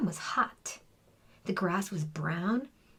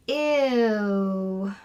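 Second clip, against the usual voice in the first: disgusted ew; 5 dB louder than usual. Acted. Class reacts in disgust.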